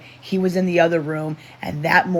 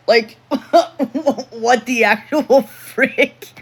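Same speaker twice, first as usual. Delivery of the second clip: higher, louder, slow words broken by laughter; laughing disbelief; 9 semitones higher, 6 dB louder, slower than usual. Real, not acted. Laughing at a viewer's comment, incredulous.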